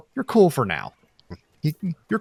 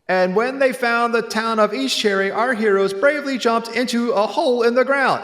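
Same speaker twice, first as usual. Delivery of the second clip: put-on child narrator voice; 7 semitones higher than usual. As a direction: narrate like a proud school-play kid. Kid narrator on stage.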